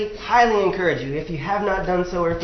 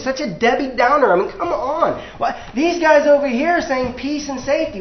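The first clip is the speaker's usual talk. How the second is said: higher, whiny complaint at Jeremiah; mocking crowd; 6 semitones higher than usual. The people scoffing at Jeremiah, in a higher, whiny mock. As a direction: needle him like an annoyed crowd. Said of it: "their response was".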